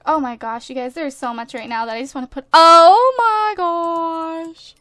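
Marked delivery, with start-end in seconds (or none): 2.5-4.8 loud, drawn-out gush; very slow, loud, overwhelmed exclamation; amazed outburst. Real, not acted. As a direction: stretch every word in delighted disbelief. Overwhelmed by cute decorations found.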